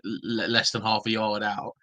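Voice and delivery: ordinary talk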